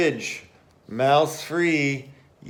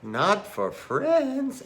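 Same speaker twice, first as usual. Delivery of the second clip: wondering question; 8 semitones higher, slower than usual. Acted.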